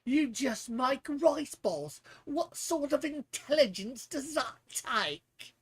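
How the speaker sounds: mocking, snooty British accent; playful British accent mocking a know-it-all. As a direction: sneer it in a put-on British accent. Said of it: "for using a British accent".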